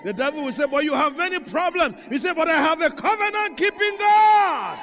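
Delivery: rising revival sermon, last line high and held long; full-force preaching. Acted. Preacher urging the church to praise God, full-force preaching. Preaches building to a shouted climax.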